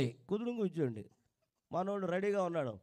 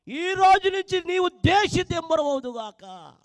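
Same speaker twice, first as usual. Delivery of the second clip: loud, raised judgement from God; God pronouncing a curse; 7 semitones higher, 11 dB louder, slower than usual. Acted. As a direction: thunder the curse down on Cain. God's curse repeated. (కయీనును శపిస్తున్న దేవుడు, గట్టిగా)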